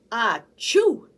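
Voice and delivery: a loud achoo; medium sneeze. Acted. Monkey giving a medium-sized sneeze, loud.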